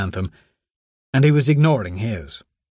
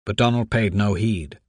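usual speaking voice

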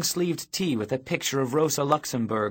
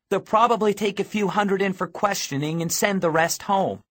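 high, raised, hard-headed arguing voice; tough, urgent girl; 7 semitones higher than usual. A narrator's reading. Girl talks boy out of going back, firm and urgent. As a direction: argue them out of turning back.

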